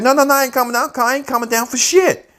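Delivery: loud, high refusal; defiant yell. Retold or imitated. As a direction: refuse to back down. Jacob refuses to calm down.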